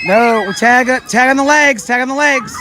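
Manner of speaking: high, loud call across the gym; shouting. Real, not acted. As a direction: yell the rule out to the players. Teacher calling to kids in game.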